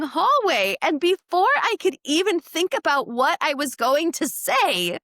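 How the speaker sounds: loud, high, amused storytelling; building into delighted disbelief; excited laughing retelling. Real, not acted. To her cohost about the girl, loud amused disbelief.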